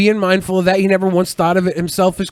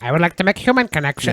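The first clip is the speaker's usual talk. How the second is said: quick, lightly voiced lizard man; lizard person; faster than usual. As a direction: rattle it off like a reptile. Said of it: "He sounds like a lizard person".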